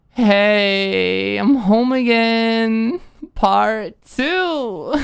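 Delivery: put-on loud, high, slurred drunk giggly voice; drunk Alicia. A narrator's reading. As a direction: play her tipsy and loud, laughing at herself. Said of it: "She laughed at her own joke".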